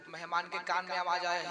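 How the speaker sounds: normal talking voice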